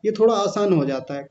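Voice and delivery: usual speaking voice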